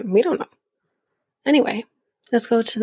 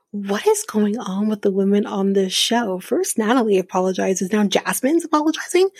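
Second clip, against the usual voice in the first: ordinary delivery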